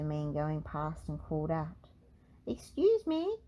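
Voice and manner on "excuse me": high, louder call; old man calling out louder and higher. Acted. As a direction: call across the garden. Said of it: "he called".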